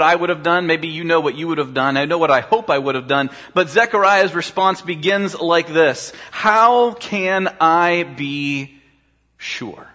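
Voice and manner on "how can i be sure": slow drawn-out question. Acted. Preacher drags out the question.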